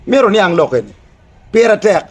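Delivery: usual speaking voice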